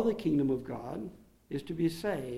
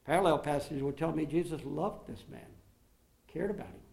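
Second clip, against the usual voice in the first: ordinary talk, nothing marked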